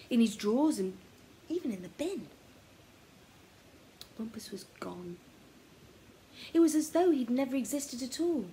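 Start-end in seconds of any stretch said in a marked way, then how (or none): none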